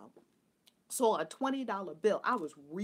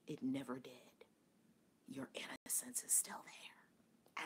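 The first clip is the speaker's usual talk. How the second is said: hushed spiritual guidance; 5 semitones lower, 8 dB quieter than usual. Real, not acted. Teacher telling listeners their innocence is God, hushed and reverent. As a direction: let each phrase settle like a meditation.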